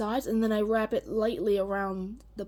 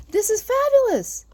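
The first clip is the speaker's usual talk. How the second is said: thrilled; 12 semitones higher, 7 dB louder than usual. Retold or imitated. Thrilled by plant growth.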